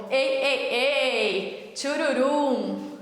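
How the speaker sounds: calling out into an echoing empty room; cave echo. Real, not acted. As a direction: call out and let it echo. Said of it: "Olha o eco!".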